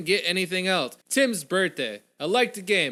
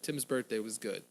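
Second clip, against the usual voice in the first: softer echo; 4 semitones lower, 8 dB quieter than usual. Real, not acted. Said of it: "repeated softer".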